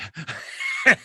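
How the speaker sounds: high, loud cracking laugh; high burst of laughter. Real, not acted. Cracking up at his buddy's dry ha ha funny, high laugh.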